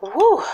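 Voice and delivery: high loud woo; excited woo. Real, not acted. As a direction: whoop at the spice. Reacting to spicy food.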